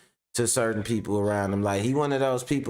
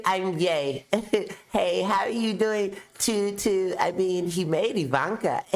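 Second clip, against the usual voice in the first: much higher, giggly [name]; [name] impression; 6 semitones higher than usual.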